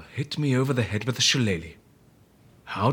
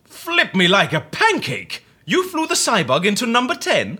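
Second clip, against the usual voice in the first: astonished; 9 semitones higher than usual. Acted. Amelia reacts in shock to the scientist's plan, high and astonished. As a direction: gasp it out in disbelief.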